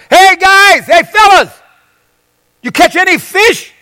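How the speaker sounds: high, loud hail across the water; calling out. Acted. Man on the shore hails fishermen offshore, calling out. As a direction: call to fishermen far off from shore.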